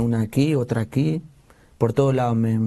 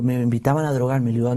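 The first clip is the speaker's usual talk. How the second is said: usual speaking voice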